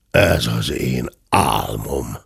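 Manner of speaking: low, slow bear grumble; deep, drawn-out muttering; muttering to himself. A narrator's reading. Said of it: "morgott magában".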